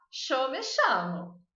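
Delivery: chamo said with an sh sound, sha-mo; Argentine-style sh on chamo. Shown as a demonstration. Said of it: "Ou você pode tentar falar".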